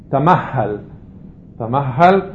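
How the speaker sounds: תמהל with a held, doubled h; dagesh in the he. Shown as a demonstration. Teacher showing an Arabic word with dagesh, doubled h in תמהל. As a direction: lengthen the ה in תמהל. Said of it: "דגש בהא".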